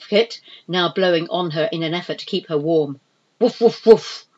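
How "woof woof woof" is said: loud, higher barking woofs; barking like a dog. Acted. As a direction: bark like a dog. Barking noise in the scene.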